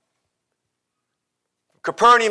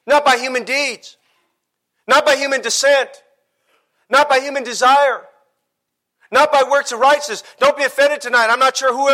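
raised, insistent sermon delivery; forceful preaching; 5 semitones higher than usual. Real, not acted. Preacher warning the congregation that works won't get them to heaven, forceful. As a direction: pound out each denial, pitched high.

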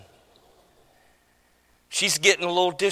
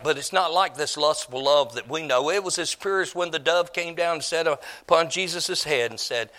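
ordinary talk, nothing marked

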